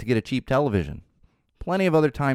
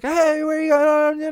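mock nagging call; 5 dB louder, much slower than usual. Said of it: "Don't be like".

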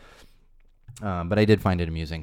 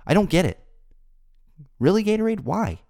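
exasperated; 9 semitones higher, 4 dB louder than usual. Real, not acted. Exasperated at Gatorade.

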